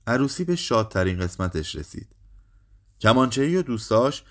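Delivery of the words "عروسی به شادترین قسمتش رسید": usual speaking voice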